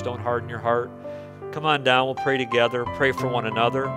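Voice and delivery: ordinary delivery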